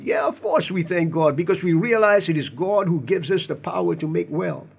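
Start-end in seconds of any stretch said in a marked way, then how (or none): none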